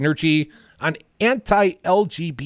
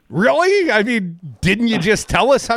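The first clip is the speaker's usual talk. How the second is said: high, loud disbelieving Really; incredulous; 7 semitones higher, 4 dB louder than usual. Real, not acted. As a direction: scoff in disbelief. Incredulous reaction to candidate's answer.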